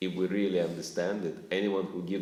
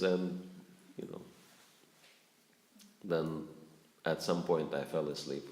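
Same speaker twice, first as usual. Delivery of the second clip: normal talking voice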